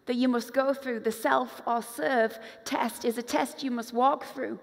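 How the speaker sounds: ordinary delivery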